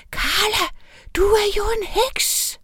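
high gasp of shock. A narrator's reading. Girl gasping at her witch friend in surprise. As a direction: gasp it out, stunned.

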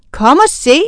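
loud excited call. A narrator's reading. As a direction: call the others over. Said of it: "råbte Anne-Sophie".

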